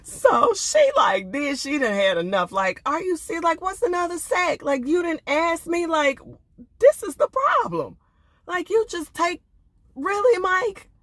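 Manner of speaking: mock indignant wife snapping; fed-up wife. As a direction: let her boil over at Mike. Voices fed-up wife Mika.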